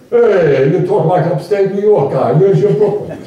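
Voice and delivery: mock the upstate guy in a touch of Brooklyn; teasing Brooklyn cousins. Cousins ribbing him, a hint of Brooklyn accent. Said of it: "my cousins would say".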